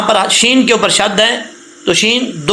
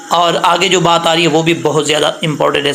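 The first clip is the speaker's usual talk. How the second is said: usual speaking voice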